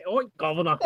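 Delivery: joking English "Oi, governor"; lightly hammed English greeting; mock English accent. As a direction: put on a badly faked English accent. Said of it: "in English accent".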